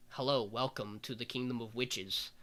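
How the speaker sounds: hint of an ancient witch's quiet welcome; old wizened witch. Old witch greets a newcomer to her kingdom, lightly voiced wizened witch. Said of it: "this old wizened witch who is like".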